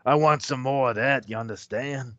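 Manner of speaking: leer it from the corner; leering biker. Retold or imitated. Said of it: "the guy with the cigar going".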